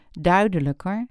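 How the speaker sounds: ordinary delivery, nothing marked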